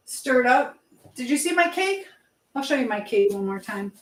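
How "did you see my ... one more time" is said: ordinary talk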